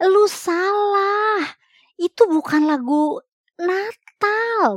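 high, slow, drawn-out correction; emphatic scolding. Retold or imitated. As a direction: lean hard on every word to set him straight. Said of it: "Gue bilang gini".

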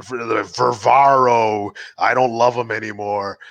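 drawn-out, mock-tragic lament; dramatic retelling. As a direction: imitate a comic's gloomy storytelling, dragging the name.

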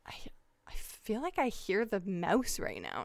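whispered, slow and puzzled; hushed whisper. Retold or imitated. Wondering aloud in the kitchen, hearing a squeak, whispered. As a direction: breathe it, straining to listen.

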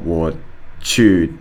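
ordinary delivery

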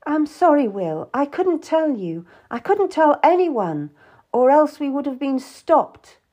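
high, anxious excuse; pleading apology. A narrator's reading. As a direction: plead for his forgiveness. Apologising to angry Will.

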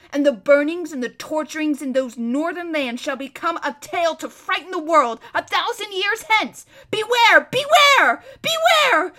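loud, high tirade building to shrieked warnings; ranting threat. Acted. Captive prince threatening his captors with vengeance, ranting. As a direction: build from menace to frenzied shouting.

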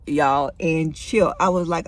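normal talking voice

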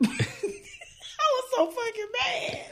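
laughing through the words; breaking into laughter while telling it. Real, not acted. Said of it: "I can laugh now".

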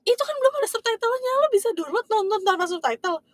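high, incredulous friend with a laugh in it; mock astonished disbelief; amazed friend. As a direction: squeal the disbelief of an impressed friend. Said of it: "Sampai temen gue kayak".

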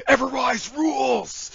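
high, loud, drawn-out wrestling promo shout; Ever-Rise catchphrase. Acted. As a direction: belt out an over-the-top tag team slogan. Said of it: "ending off every single ... interview or promo".

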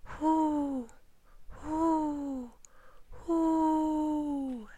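high, long owl hoots; three drawn-out, high hoots. A narrator's reading. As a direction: hoot like a mother owl. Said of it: "and hooted".

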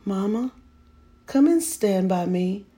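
put-on begging child. A narrator's reading. Boy begging his mother in the dark, pleading. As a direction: beg her to stay close. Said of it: "I begged".

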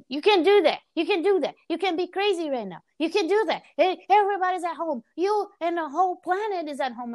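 high, insistent mock scolding; yelling mom. Mom forbidding daughter's venting, high insistent mock yelling. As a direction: mimic a mom laying down the law. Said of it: "she start yelling".

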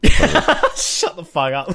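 laughing through the words, slow; cracking up while telling him off. Real, not acted. Laughing at the joke.

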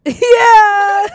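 loud, high laughing yeah; laughing shout. Real, not acted. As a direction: burst out laughing on it. Laughing shout after savage remark.

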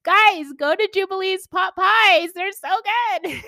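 loud, high, cheering shout-out; rally the listeners at full volume; excited plug. Real, not acted. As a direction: belt out an enthusiastic recommendation. Calling listeners to support friend's shop.

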